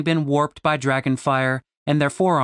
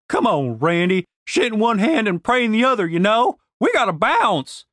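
impatient Southern woman; 7 semitones higher, 3 dB louder than usual. A narrator's reading. Denise urging.

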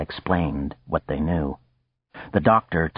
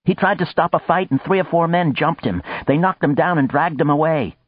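high, hurried telling of the attack; agitated; 9 semitones higher than usual. Acted. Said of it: "never seen the little man so agitated".

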